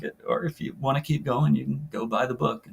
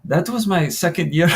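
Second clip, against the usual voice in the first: higher, lightly attempted French accent; amateur French accent, pitched up; 6 semitones higher, 4 dB louder than usual. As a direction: try a French accent for the player. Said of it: "she's got a French accent".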